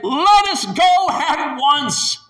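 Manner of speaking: high, loud and slow rallying cry; bold call. Acted. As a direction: urge them forward with full conviction. Caleb voiced urging boldly.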